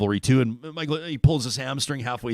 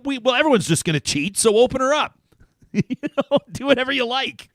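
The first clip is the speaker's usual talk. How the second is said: mock carefree cheater; 13 semitones higher, 5 dB louder than usual. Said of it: "is you just go".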